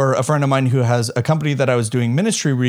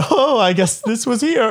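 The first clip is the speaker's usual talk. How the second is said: high, mock German-accented teacher; a half-hidden German accent, done again; teacher in German accent, thrown off guard; teacher's German accent; 8 semitones higher than usual. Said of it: "I shouldn't do the accent".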